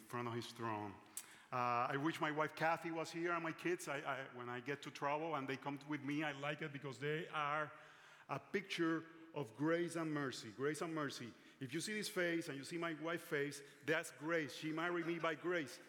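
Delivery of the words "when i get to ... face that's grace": ordinary talk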